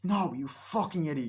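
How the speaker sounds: mock-angry insult; acted berating. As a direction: cut them off with a scolding. Berating in retold quarrel.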